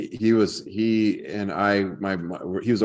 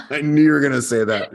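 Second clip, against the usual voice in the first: laughing out the words, louder and higher; 6 semitones higher, 8 dB louder than usual. Real, not acted. Host laughs at colleague's firing joke, laughing. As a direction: laugh through it.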